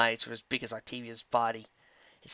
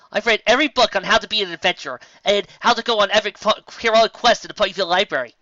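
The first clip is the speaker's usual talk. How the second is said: loud, higher, proud rush; loud, eager boast; excited bragging; 8 semitones higher, 10 dB louder, faster than usual. A narrator's reading. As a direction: show off your knowledge.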